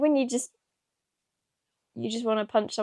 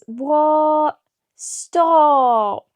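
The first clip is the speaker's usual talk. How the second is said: shocked outcry; 5 semitones higher, 7 dB louder than usual. Real, not acted. Betrayal by Blue Fingers.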